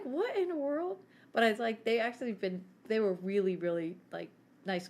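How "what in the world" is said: higher-pitched mock disbelief; retold bewilderment. Recalling her baffled reaction to the owner, bewildered.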